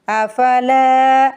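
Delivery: अफला with the ला drawn long; long lam. Shown as a demonstration. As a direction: stretch ला in अफला. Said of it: "लाम को खींच के अदा करेंगे". (अफला में लाम को खींच कर)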